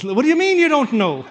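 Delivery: high, loud, incredulous Scottish angel; hammed-up Scottish accent, demanding an answer; little put-on Scottish accent. Angel challenging the thief at the gate, hammed-up Scottish accent. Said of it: "That's my little accent".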